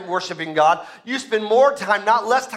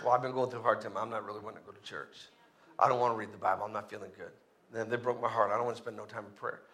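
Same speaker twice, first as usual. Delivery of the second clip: low, very soft mumbled excuse; mock dejected; 11 semitones lower, 13 dB quieter, faster than usual. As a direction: sink into a discouraged churchgoer's self-pitying murmur. Voicing a discouraged believer skipping church.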